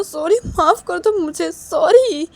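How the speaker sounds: high, urgent begging; pleading apology. Acted. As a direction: beg an angry partner to forgive you. Neha begs Sneha for forgiveness. (गिड़गिड़ाते हुए माफ़ी)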